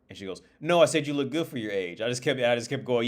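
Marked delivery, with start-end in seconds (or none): 0.6-2.0 louder, higher imitation of her insisting; insistent correction. Waitress repeating herself to the customer, insistent correction. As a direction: push the correction with raised voice.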